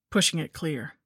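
plain reading voice

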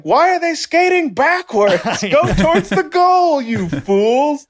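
high-pitched mock outrage; exasperated fan. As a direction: squeak it out in disbelief. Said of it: "watching hockey being like".

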